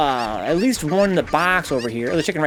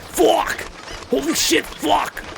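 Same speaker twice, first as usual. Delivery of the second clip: high squawking panic; panicked chicken voice; 11 semitones higher, much slower than usual. Retold or imitated. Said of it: "Chicken's like".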